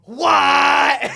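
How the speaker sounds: loud, high shocked shout; shocked what. Retold or imitated. Friend reacting to a shock, a stunned yell. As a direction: yell it in disbelief. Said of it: "I was like".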